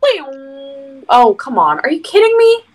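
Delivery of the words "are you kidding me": outraged disbelief. Real, not acted. Reaction to low damage.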